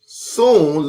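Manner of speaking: plain reading voice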